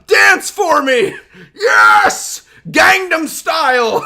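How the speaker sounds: slow, stretched, high and loud yells; ecstatic shouting. Real, not acted. Streamer urges the dancing player on, slow ecstatic shouts. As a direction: drag each word out in a joyful scream.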